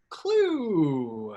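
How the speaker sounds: drawn-out, falling game title reveal; drawn-out announcement. Real, not acted. Host unveiling tonight's board game, drawn-out announcement. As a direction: stretch the word long as a big reveal.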